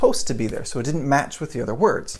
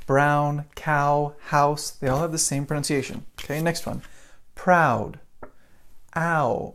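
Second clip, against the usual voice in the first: ordinary delivery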